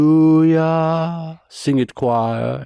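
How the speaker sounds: slow, high drawn-out hallelujah; imitated preacher. Preacher uncle cueing the choir during healing. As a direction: stretch the praise, then cue the choir. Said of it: "and he goes".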